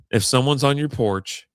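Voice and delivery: ordinary delivery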